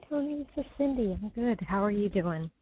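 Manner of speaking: ordinary talk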